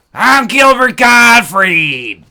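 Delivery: throat-shredding high, slow shout; Gilbert Gottfried. Acted. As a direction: scream it out, stretched and very loud. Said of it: "have a Gilbert Gottfried impression".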